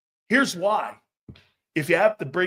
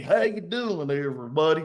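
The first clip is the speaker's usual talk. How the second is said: fake Southern accent; 3 semitones lower than usual. Host mocking a coach's fake Cajun greeting, phony Southern accent. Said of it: "phony-ass accents".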